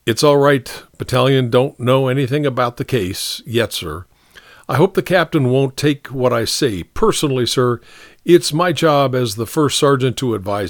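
lightly voiced sergeant, respectful; placating sergeant. Sergeant advising the captain on the charge, mildly placating. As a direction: reassure and advise a superior.

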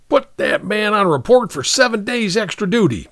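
high angry shriek; shrieking captain. A narrator's reading. Humiliated captain shrieking an order at Burke. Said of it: "the captain shrieked at Burke".

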